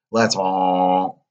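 the word honked like a donkey. Acted. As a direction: let a donkey honk swallow the words. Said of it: "said Pinocchio".

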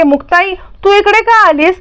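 put-on high, eager little girl's voice; a little girl calls out happily to her mother; child's voice. A narrator's reading. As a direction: sound like a small girl greeting her mother.

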